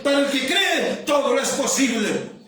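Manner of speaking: forceful, driving sermon voice; impassioned pulpit delivery; fervent preaching. Real, not acted.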